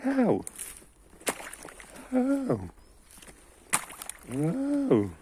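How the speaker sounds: high, loud pained yelps. Real, not acted. Gamer yelping as he gets hit. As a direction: yelp sharply each time.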